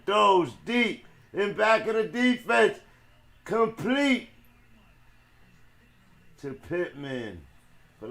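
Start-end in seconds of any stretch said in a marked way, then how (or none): 0.1-4.3 louder, much higher big-play call; raised, high-pitched play-by-play burst; excited play call. Real, not acted. Live call of a deep completion.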